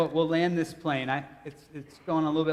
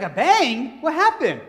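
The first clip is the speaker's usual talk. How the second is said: high, loud baffled questions; mock bewilderment; 7 semitones higher, 8 dB louder, slower than usual. Preacher imitating the woman who hit his car, mock bewildered. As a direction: play a driver who has no idea, hammed up. Acting the driver unaware of crash.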